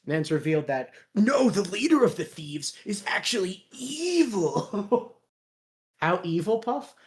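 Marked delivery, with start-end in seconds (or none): 1.2-5.2 high, drawn-out mock wail; hammed-up shock at a plot twist, slow; mock-dramatic reveal.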